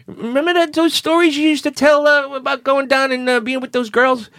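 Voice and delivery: a hint of sweet-talk; coaxing high voice. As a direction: coax him along sweetly. Coaxing question to Tony.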